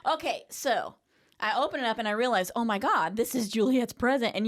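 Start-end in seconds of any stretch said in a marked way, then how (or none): none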